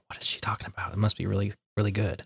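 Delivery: near-whispered puzzled thought; hushed aside. Acted. Listeners privately baffled by a smart-sounding speaker, hushed aside. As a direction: drop to a murmur, as if privately impressed.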